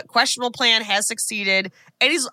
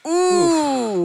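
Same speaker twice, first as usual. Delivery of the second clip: startled ooh; 9 semitones higher, 3 dB louder than usual. Retold or imitated. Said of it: "I'm like".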